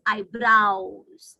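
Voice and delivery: EYEBROWS slowly as eye-browz, rhyming with cows; correct eyebrows. Shown as a demonstration. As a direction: model "eyebrows" with an ow vowel. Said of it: "It's not eyebrows, it's eyebrows".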